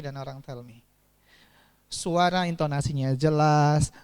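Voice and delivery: normal talking voice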